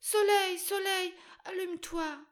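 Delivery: put-on a weeping child calls out high and desperate; desperate plea. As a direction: beg the sun to light up. Crying girl begs the sun.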